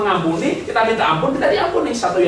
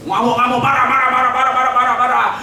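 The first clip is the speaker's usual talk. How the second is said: loud, high rant of marah; hammed-up angry husband, loud and high; mock raging husband; 8 semitones higher, 6 dB louder than usual. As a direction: rattle off the angry repeats loudly.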